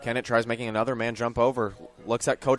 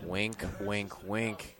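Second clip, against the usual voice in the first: teasing aside; 4 semitones lower, 11 dB quieter, much slower than usual. Real, not acted. Co-host plays along with joke.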